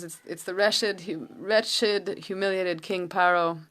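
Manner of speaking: ordinary talk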